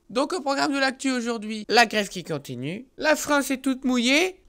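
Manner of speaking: upbeat presenter opening; show host intro. Acted. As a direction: announce it like a TV host. Announces the show again.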